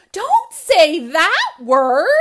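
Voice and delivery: comic refrain shout. A narrator's reading. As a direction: belt the refrain like a joke punchline.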